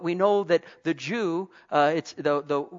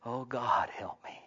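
softer, fading to a breathy hush; mock pained plea; 5 semitones lower, 8 dB quieter than usual. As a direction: pray under your breath after biting your tongue. Mock prayer after biting tongue.

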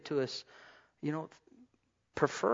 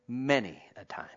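whispered, softer and lower; hushed aside; 5 semitones lower, 5 dB quieter than usual. Acted. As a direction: let it drop to a whisper. Mother's saying, hushed aside.

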